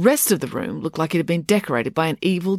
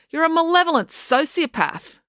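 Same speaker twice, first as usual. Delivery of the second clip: high-pitched, indignant insult; exasperated accusation; 12 semitones higher than usual. A narrator's reading. April accusing Loretta in a quarrel, exasperated and indignant.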